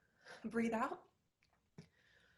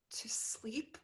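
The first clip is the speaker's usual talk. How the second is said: breathy whisper; whispered longing; 4 dB quieter than usual. Acted. Molly wishing she could sleep, whispered. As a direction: breathe the words out, drained. Exhausted monologue after panic.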